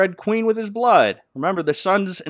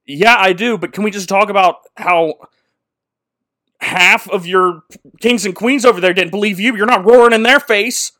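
indignant Trumpkin; 5 semitones higher, 7 dB louder than usual. Retold or imitated. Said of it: "And Trumpkin's like".

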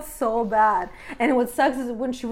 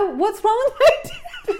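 retold panic; 9 semitones higher, 4 dB louder, slower than usual. Acting out the panicked daughter.

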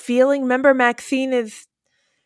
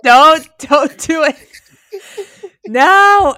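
louder, slow pleading warning; warning the screen; 3 semitones higher, 7 dB louder, slower than usual. Retold or imitated. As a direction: beg her not to do it.

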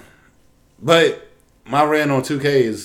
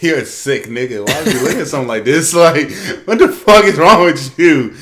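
laughing self-scolding; 4 semitones higher, 7 dB louder than usual. Retold or imitated. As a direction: scold yourself while cracking up. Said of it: "I'm like".

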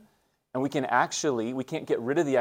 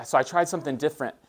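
ordinary talk, nothing marked

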